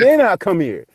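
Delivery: high, loud acted condition; retold loud bargaining. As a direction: call back your terms to an armed man. Retold refusal facing a gun.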